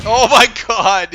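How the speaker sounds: high, slow, drawn-out scream; shocked scream. Real, not acted. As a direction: scream in disbelief, stretched out. Blinded by flashbang again.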